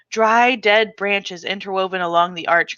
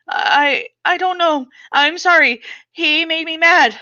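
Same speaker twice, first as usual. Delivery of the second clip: high, fearful stammering excuse; scared child; 8 semitones higher than usual. Acted. Frightened girl answering her father, high and scared. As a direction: explain yourself in fear.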